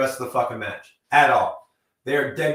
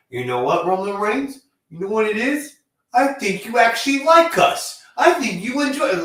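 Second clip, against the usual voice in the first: higher, louder mock earnest taunt; imitating Sami Zayn; 8 semitones higher, 4 dB louder than usual. Mocking Sami Zayn taunting Roman Reigns, higher and louder. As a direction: play the smug Canadian wrestler.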